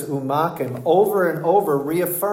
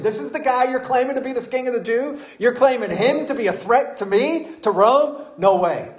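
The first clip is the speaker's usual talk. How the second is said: higher, quick scornful sneer; mocking Pilate; 8 semitones higher than usual. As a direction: scoff at the Sanhedrin's claim. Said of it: "it's said as a mock".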